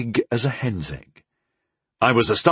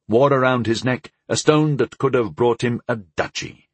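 ordinary delivery, nothing marked

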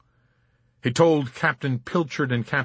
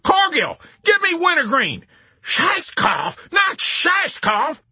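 high, loud panicked shouting; horrified general losing all composure; hysterical; 6 dB louder, slower than usual. A narrator's reading. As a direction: call for help in hysterics. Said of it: "composure went up in hysteria".

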